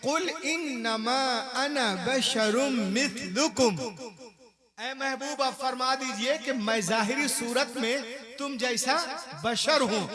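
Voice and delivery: loud, fervent sermon delivery; fiery pulpit preaching; preaching at full force. Acted. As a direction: rouse the congregation.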